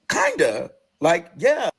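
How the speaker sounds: retold choked-up reluctant admission; emotional. Patient asked if adoption led here, emotional. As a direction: act out answering slowly, close to tears. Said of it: "it made me so fucking emotional".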